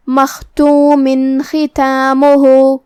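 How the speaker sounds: مختوم ختامہ slow, clear nun before خ with no nasal hum; tajweed demo. Shown as a demonstration. Teacher showing students the clear tanween, slow. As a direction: say مختوم ختامہ as makhtumin khitamuh, nun crisp. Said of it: "تنوین کو ظاہر کریں گے".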